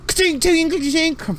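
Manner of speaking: high, bright mock clinks from the back; ping out tiny metal clinks; metallic clinking. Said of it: "You hear".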